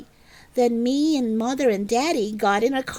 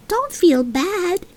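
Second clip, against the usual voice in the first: higher, slow comforting toy voice; toy elephant; 8 semitones higher, slower than usual. Acted. Stuffed elephant comforting Junie B in bed, higher and gentle. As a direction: soothe her as a gentle stuffed animal.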